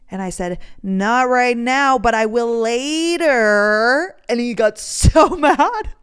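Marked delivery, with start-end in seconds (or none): 0.8-4.1 loud, drawn-out teasing refusal; slow, goading singsong; mocking. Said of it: "I think I, like, mocked him". 4.3-5.9 high, loud words breaking into laughter; amused, drawn-out delivery bursting into a laugh. Real, not acted. Amused at his being mad.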